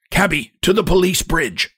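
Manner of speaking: high, cracked cry of a hunchback; thin, cracked-voiced hail. A narrator's reading. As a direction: call for a cab in a cracked, high voice. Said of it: "cries in a cracked voice".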